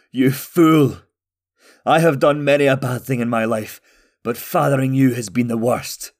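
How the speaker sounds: loud bitter rebuke, easing slightly by the end; angry father. A narrator's reading. Father to the son who fought him, angry.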